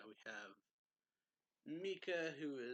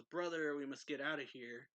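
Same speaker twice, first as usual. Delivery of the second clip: raised-voice urgent call, easing off after the first word; retold yell; 2 semitones higher, 6 dB louder than usual. Sister urging brother to flee, retold yell. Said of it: "And she yells".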